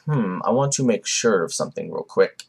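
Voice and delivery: lightly voiced low, quiet musing; a hint of a low, pensive villain; Madara. Vlogger voicing Madara pondering his next move, low and quiet. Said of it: "So Madara's like".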